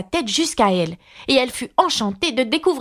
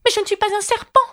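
high-pitched indignant retort; indignant Alice, voice pitched way up; 12 semitones higher, faster than usual. A narrator's reading. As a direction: protest as an offended little girl. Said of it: "riposta Alice d'un ton indigné".

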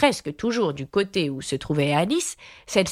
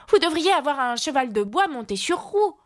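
put-on higher, exasperated little girl; losing patience; 9 semitones higher than usual. A narrator's reading. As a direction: cry out in mild exasperation. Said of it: "perdant patience". (fillette qui perd patience, voix aiguë)

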